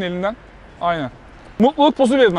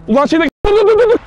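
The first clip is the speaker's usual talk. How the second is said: shouted, climbing higher to a yell; calling out; 11 semitones higher, 5 dB louder than usual. Real, not acted. Host shouting at a passerby to stop, loud call. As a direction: call him to stop from afar.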